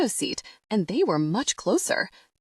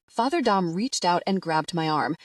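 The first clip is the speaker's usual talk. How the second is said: usual speaking voice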